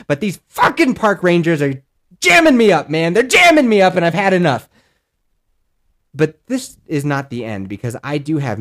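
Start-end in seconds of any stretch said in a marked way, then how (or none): 0.1-3.1 louder, annoyed grumble about the rangers; fed-up complaint. Real, not acted. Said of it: "repeated complaint, first take". 3.1-4.7 much louder, higher, fed-up cry; fed-up shout. Real, not acted. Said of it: "repeated complaint, louder second take".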